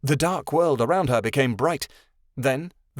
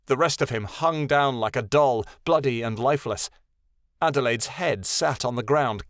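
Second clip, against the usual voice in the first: usual speaking voice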